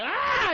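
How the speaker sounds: high-pitched retold scream; mock scream. Woman about to be chopped up, mock scream. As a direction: let out a mock cry of fright. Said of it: "she was like".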